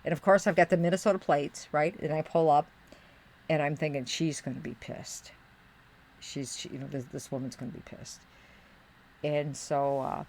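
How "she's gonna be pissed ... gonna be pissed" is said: low, soft aside; hushed, lower retold thought; inner thought. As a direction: think it under your voice. Thinking to herself as she pulls up.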